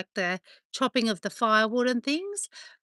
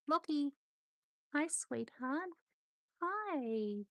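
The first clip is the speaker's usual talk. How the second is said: higher and softer, cooing to the dog; soft pet talk; 6 semitones higher, 10 dB quieter, much slower than usual. Real, not acted. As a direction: coo hello to a little dog. Greeting her dog tenderly.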